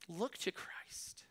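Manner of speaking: almost whispered, slow exhortation; hushed plea. Real, not acted. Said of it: "quiet intense exhortation".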